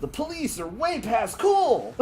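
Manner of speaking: high, loud, slow cartoon hero; cartoon Sonic voice. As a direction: hype it up like a kids' cartoon.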